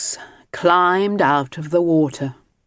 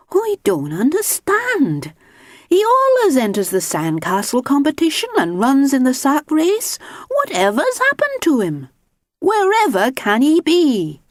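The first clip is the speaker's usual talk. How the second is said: worried Missus Grinling; 10 semitones higher, 3 dB louder than usual. Acted.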